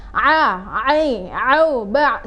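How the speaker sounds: overdone ain, slow and strained; overdone recitation; exaggerated ain. Shown as a demonstration. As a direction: push the a, ai, au, ba too hard from the throat. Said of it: "penyebutan berlebihan".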